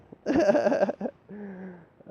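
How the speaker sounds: a long, high laugh. Real, not acted.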